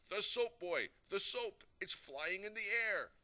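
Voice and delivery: high, loud alarm; astounded shout. A narrator's reading. Daddy seeing soap float by itself, astounded shouting. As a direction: cry out at something impossible.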